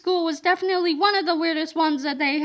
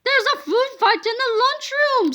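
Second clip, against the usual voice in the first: higher alarmed call; shouted; 7 semitones higher than usual. A narrator's reading. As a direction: raise the alarm. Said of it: "shouted Mister Rustworthy".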